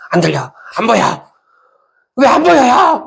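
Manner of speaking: high, loud, climbing questions; exasperated acted shout. Preacher acting God at people with closed ears, exasperated yell.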